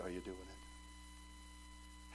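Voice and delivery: slow, hushed, breathless whisper; whispered question. Acted. Preacher quietly challenging the church, in a slow whisper. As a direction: lean in and whisper it.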